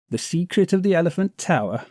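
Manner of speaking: high, fast, incredulous exclamation; a rogue amazed anyone would ask; exclaiming. Acted. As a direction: scoff in disbelief at the question. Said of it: "he exclaimed".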